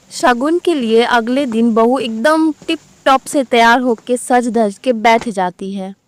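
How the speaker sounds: usual speaking voice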